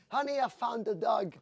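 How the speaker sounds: high, loud call out the window. Retold or imitated. Husband calls to wife in the courtyard, loud call. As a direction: shout it down to the courtyard.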